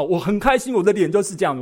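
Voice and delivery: normal talking voice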